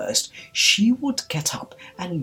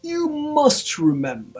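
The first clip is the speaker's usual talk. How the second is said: high, loud, slow demand; forceful high royal order; insistent king; 12 semitones higher, 6 dB louder than usual. A narrator's reading. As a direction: press him hard, drawing it out. King threatens Hatter.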